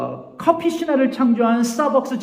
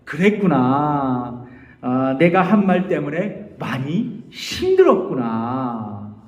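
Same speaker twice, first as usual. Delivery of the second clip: slow, lower, gentle reply; empathic reply; 7 semitones lower, slower than usual. Shown as a demonstration. Pastor models answering a hurt partner, slow and gentle. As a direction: take your time, soothe them. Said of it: "그 얘기를 들었으면".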